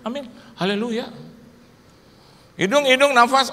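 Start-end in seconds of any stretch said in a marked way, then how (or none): 2.6-3.5 Spirit questioning. Retold or imitated. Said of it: "roh ngomong sama saya".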